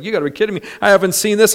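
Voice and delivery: high, loud mock disbelief; incredulous friend. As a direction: imitate a friend who can't believe it. Retelling friend's disbelief at car window.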